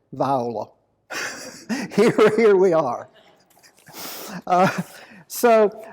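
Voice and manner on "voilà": VIOLA said in place of French voilà, pitched low; jumbled French voilà. Acted. Speaker joking to his audience as a dyslexic Frenchman, mispronounced low VIOLA. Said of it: "as a dyslexic Frenchman said".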